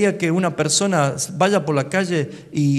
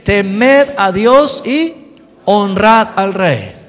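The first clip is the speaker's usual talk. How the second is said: dicho fuerte y lento; lectura fuerte; 5 semitones higher, 9 dB louder, much slower than usual. Shown as a demonstration. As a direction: proclama el mandato. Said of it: "¿Qué más?". (lee con la iglesia fuerte y pausado)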